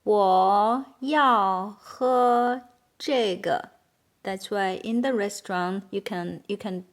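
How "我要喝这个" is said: drawn-out syllables, firmly placed; slow model sentence. Shown as a demonstration. Teacher modelling "I want to drink this one", very slowly. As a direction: stretch each word for learners to copy.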